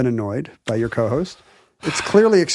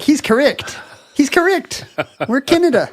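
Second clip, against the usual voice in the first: much higher, squeaky eager agreement; squeaky mock New Zealanders; 11 semitones higher than usual. New Zealanders agreeing with the host, high squeaky mock voice. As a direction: chirp it like tiny excited Kiwis. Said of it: "They're like".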